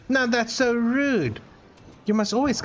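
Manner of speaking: playfully affronted, pitched right up; mock offence. Mock scolds viewer for no hello.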